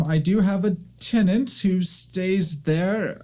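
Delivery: put-on high, slow elderly temple keeper; old woman greeting visitors, high and kindly. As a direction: speak up politely as an old librarian.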